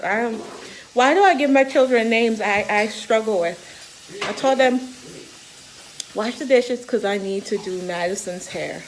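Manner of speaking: normal talking voice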